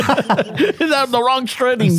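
slow words squeezed out through laughter; real laughter, slowed down; laughing. Real, not acted. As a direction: crack up while saying it.

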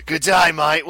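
playful Aussie g'day back at him; mock Australian. Host answers the co-host with a mock Australian g'day. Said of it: "Why are you talking like that?".

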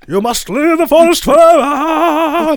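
high, slow, loud grand pronouncement; hammy Anthony Hopkins. Retold or imitated. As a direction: boom it like a solemn old sage. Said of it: "Anthony Hopkins shows up".

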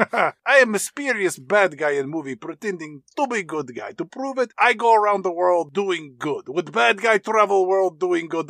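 mock Romanian accent, shady villain; Tim Curry. Villain pretending to be good guy, mock Romanian accent. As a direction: play the sly bad guy in hammy Romanian-accented English. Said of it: "he's got this Romanian accent".

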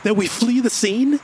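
high, indignant questioning; incredulous. Acted. Narrator balking at James's plan to flee, incredulous. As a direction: challenge him in disbelief.

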